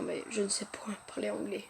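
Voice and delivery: whispered mock-sad admission; hushed confession. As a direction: confess it barely above a whisper. Aveu faussement triste, très bas. (aveu chuchoté)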